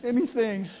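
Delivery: high, pleading mock beg. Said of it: "You're like".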